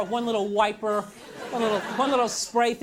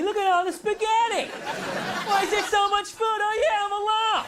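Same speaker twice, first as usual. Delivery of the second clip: high, bright sing-song mock cheer; mock chirpy voice; 11 semitones higher than usual. Comic voicing empty chairs that taunt him, mock cheerful. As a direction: sound like the furniture taunting him. Spaghetti mocking him for being alone.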